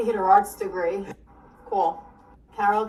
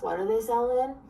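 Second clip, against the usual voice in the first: normal talking voice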